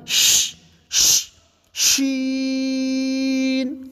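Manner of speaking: ش as a spreading hiss, then شین held long; hissed ش, then long even شین; spreading sh sound. Shown as a demonstration. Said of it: "پہلے پھیلاؤ کے ساتھ".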